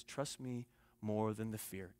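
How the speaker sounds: very soft, low and slow; hushed, steady reassurance; calm voice. Retold or imitated. Uncle looking each little girl in the eye, calm and hushed. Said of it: "He's just talking to them in a calm voice".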